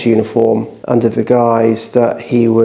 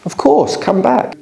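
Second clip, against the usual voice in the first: much higher, eager official voice; high-pitched welcome; 11 semitones higher than usual. Retold or imitated. Ministry officials welcome him back.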